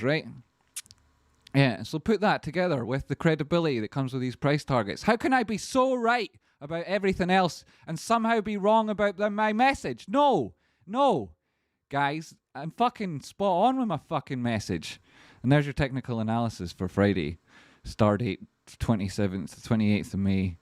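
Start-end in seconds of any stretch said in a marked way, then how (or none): 5.0-15.0 a real full-throated rant, pitch way up; angry rant. Real, not acted. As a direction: shout it at the doubters, fired up. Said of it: "sorry for getting so angry".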